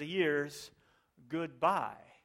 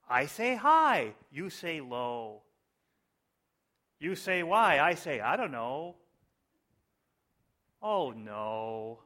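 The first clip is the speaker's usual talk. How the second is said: mock-dramatic lyric recital; 4 dB louder, slower than usual. Preacher performing Beatles lyrics for the congregation, mock-dramatic. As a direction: swing theatrically from big question to grave answer. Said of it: "I hope you're as moved by these".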